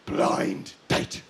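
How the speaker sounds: very low, hushed title drop; mock-solemn reveal. Comic naming the top participation show, mock-solemn. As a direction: sink deep for a dramatic unveiling.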